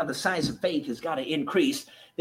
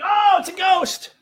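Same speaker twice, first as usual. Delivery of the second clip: scared cry; 14 semitones higher, 5 dB louder than usual. Retold or imitated. Disciples panic at a ghost.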